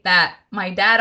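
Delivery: ordinary delivery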